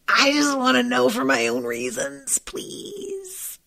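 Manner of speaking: mock pleading. Nosy viewer begging the character, mock plea. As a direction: beg sweetly, then whine "please". Begging to know how many.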